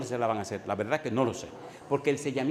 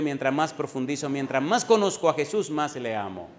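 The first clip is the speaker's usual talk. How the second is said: fiery preaching; 3 semitones higher, 5 dB louder than usual. Acted. Pastor to congregation on loving Jesus, fiery preaching. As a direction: preach it hard, no emotional love here. Preacher builds to full force.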